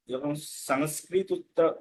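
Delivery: ordinary delivery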